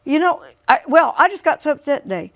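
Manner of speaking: put-on high, loud upset boy; upset teen. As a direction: blurt it out like a hurt twelve-year-old. Son's retold words.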